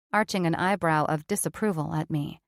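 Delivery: ordinary delivery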